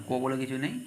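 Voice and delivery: ordinary talk, nothing marked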